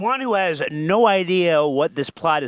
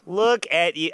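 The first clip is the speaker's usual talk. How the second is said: usual speaking voice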